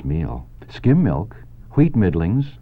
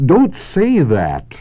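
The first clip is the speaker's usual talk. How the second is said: much higher, louder, slow groan of dismay; groaning plea; 10 semitones higher, 6 dB louder, slower than usual. Acted. Wilbur pleading with Charlotte, groaning in dismay. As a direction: moan it out in disgust. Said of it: "groaned Wilbur".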